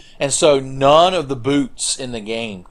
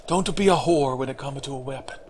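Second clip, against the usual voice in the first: mock Japanese accent, softer; Miyazaki; 2 semitones higher, 5 dB quieter than usual. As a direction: imitate a director's advice in a Japanese accent. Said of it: "Straight from the man himself".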